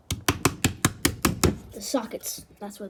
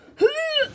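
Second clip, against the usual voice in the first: sharp high gasp; startled gasp; 7 dB louder than usual. Real, not acted. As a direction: gasp in surprise. Finds spider webs inside.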